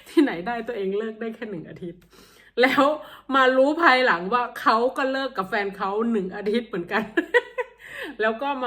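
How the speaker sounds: loud, amused telling breaking into laughter; laughing confession. Real, not acted. Confessing to viewers that both fibbed about breakups, laughing. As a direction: let real laughter spill through the words.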